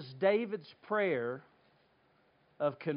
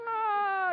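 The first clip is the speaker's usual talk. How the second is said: high drawn-out mock howl; imitated friends' reaction; 4 dB louder than usual. Preacher mimics friends busting a gut laughing, high howl. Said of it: "his friends busted a gut laughing".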